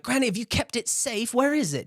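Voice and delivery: put-on very high, squeaky, wheedling kid voice; cheeky grandson. Jinx wheedling his granny for cookies, very high and cheeky. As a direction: chirp it like a cheeky kid angling for treats. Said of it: "then he's like".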